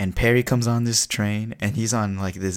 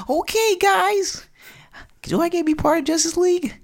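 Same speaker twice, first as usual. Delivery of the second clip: put-on very high, loud kid voice; eager boy; 5 dB louder than usual. Boy asks heroes to join Justice League, squeaky and eager. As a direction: squeal it like an excited little boy. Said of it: "this boy, this boy, Barry Batson, he's like".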